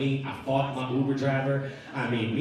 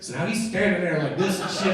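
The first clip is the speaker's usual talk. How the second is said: ordinary talk, nothing marked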